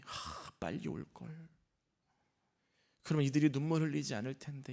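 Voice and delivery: very soft, low, regretful musing; imagined regret. Retold or imitated. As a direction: murmur it like a private regret. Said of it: "이런 마음이셨을까요?".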